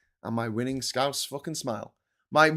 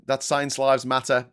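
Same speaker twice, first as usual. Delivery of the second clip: ordinary delivery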